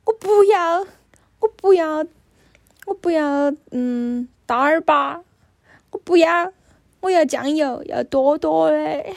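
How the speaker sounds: imitating an angry little boy, high and loud; 气狠狠. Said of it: "他就气狠狠地说". (模仿小孩气狠狠地耍赖)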